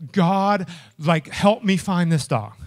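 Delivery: loud retold plea; desperate prayer. Owner begging God to find his dog, desperate prayer. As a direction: cry out to God for help.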